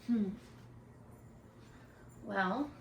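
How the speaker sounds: usual speaking voice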